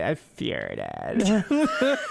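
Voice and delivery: put-on laughing valley girl "farted" with ar as ear; half-laughing valley girl drawl; valley girl accent. Retelling the girl to his friend, valley girl accent. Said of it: "Valley girl straight up".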